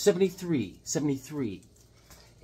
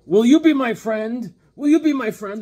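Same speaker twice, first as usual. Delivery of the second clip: pushy lonely man; 8 semitones higher, 10 dB louder than usual. Acted. Lonely man pestering strangers, overeager pleading. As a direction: beg it too eagerly. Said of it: "keeps going up to people".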